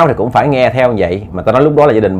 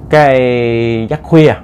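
drawn out slowly; much slower than usual. A narrator's reading. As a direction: linger on Cái giấc khuya like a ghost tale. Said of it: "giấc khuya nha các bạn". (kéo dài chậm rãi chữ giấc khuya)

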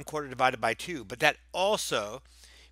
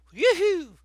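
high, loud whoop; mock cheer; 9 dB louder than usual. Teacher cheering the word problem, mock excited whoop. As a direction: whoop it out in pretend delight.

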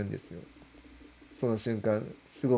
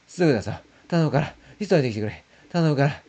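rising, louder desperate begging; frightened pleading; 7 semitones higher, 6 dB louder than usual. Retold or imitated. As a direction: plead urgently, voice climbing in fear. Begging manager to hurry, frightened.

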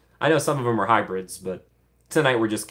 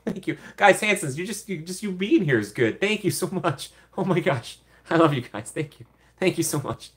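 touched thanks; 6 semitones higher than usual. Real, not acted. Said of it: "my little heart".

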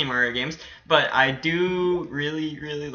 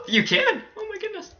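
amazed squeal. Real, not acted. Gamer finding the jump can be made, amazed squeal. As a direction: squeal it, then shrink to a tiny high voice. Discovers a move works.

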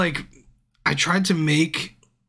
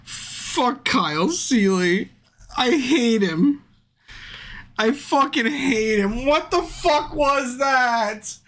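frustrated anger; 9 semitones higher, slower than usual. Real, not acted. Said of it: "I'm so fucking mad".

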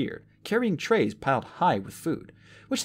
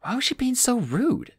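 ordinary delivery

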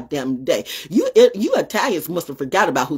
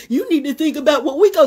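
mocking quote; 9 semitones higher, 3 dB louder than usual. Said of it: "Some little sussy ass boy said".

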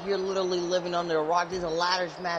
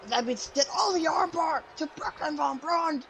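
much higher, shouted play-by-play; excited call; 9 semitones higher than usual. Real, not acted. Commentator calling an arm bar, shouting. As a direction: yell the move.

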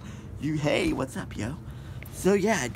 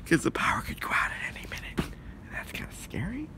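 soft scared whisper; 8 dB quieter than usual. Real, not acted. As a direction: whisper the fear. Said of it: "whispered worry".

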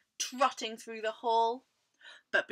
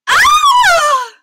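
loud, high, drawn-out scream; falling scream; 25 dB louder than usual. A narrator's reading. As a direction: scream as the floor gives way. Mouse falls through the floor.

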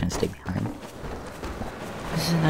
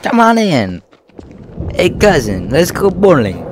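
put-on loud, clumsy Eastern European accent; badly faked Eastern European accent; bad Roman accent; 6 semitones higher, 7 dB louder than usual. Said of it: "I suck at the accent".

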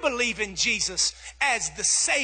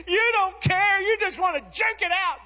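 high, louder mock accusation; hammed-up hurt protest, pitched up; upset child; 8 semitones higher, 3 dB louder than usual. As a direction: imitate a daughter wailing at her dad. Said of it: "she cried and she bawled".

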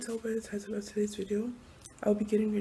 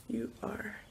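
whisper; 7 dB quieter than usual. Real, not acted. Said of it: "my morning voice".

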